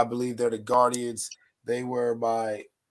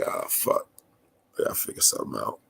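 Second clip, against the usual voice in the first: whispered aside; 11 semitones lower, 4 dB quieter, faster than usual. Retold or imitated. Retold inner reaction to [name].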